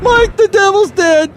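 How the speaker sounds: loud, piercing high character voice; Floyd the Floor Man. Acted. Floor cleaner reporting devil's death to host, triumphant. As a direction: proclaim victory in falsetto. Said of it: "this is Floyd the Floor Man".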